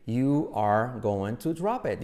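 very slow "You are going to drop it", each word separate. Shown as a demonstration. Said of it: "hay que decirlo rápido".

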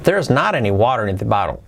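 fluent unbroken sentence; brisk joined-up model. Shown as a demonstration. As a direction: run it together, falling away after "not". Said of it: "Sería así".

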